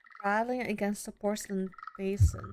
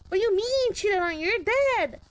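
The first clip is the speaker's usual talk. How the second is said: much higher and louder, shouting him down; furious shout; 11 semitones higher, 4 dB louder than usual. Acted. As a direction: rage at the accusation, full voice. Furious shouting at husband's ghost.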